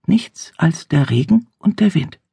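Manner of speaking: normal talking voice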